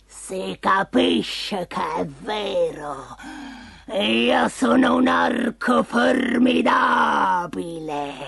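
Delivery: very slow, drawn-out monster voice; boastful ogre. A narrator's reading. Ogre boasts to the cat, slow complacent monster voice. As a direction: stretch each word with an ogre's self-satisfaction.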